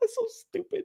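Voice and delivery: soft, very high laughing squeak. Real, not acted. Mocking his younger self, laughing. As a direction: squeeze it out through laughter.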